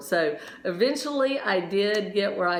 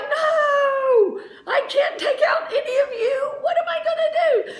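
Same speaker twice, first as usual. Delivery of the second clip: high, loud, panicky cry with a laugh in it; panicked; 4 dB louder than usual. Retold or imitated. Skier panicking at a line of people, high half-laughing cry.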